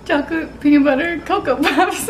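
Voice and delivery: high giggling through words; laughing. Real, not acted. Laughing at her wrong name.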